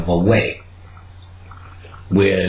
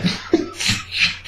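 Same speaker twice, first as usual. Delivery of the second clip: breathy falsetto laugh; 5 dB quieter than usual. Real, not acted. Laughing at himself.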